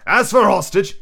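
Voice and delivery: gruff pirate, loud and jolly. Acted. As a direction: play Long John Silver boasting to his crew. Silver's pirate voice.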